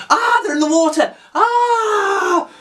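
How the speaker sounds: very high mock scream; theatrical running-away screams; over-the-top panic. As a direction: shriek as if fleeing, hammed right up. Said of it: "It's like over the top running away".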